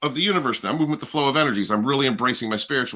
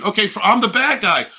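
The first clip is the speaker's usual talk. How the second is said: sarcastic rant; 7 semitones higher than usual. Real, not acted. Sarcastic rant, repeated refrain.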